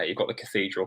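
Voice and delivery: usual speaking voice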